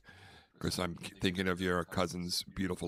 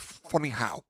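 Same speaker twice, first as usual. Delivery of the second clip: mock Goodfellas line; 5 semitones higher than usual. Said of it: "And I went".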